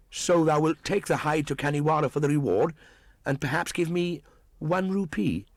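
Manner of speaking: put-on high, cool boyish voice; a defiant jungle boy mocking the hunter; scornful boy, pitched high; young Mowgli.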